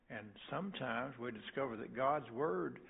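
normal talking voice

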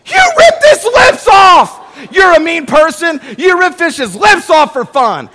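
high, loud shrieking outrage with a laugh in it; outraged wife. Retold or imitated. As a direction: shriek the accusation at him. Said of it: "ripped the lips right off".

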